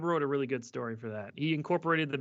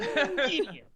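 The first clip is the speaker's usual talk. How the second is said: mock guard insult.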